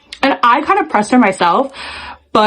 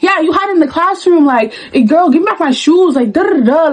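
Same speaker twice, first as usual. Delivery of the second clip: taunting; 6 semitones higher than usual. Retold or imitated. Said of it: "in my head, I'm like".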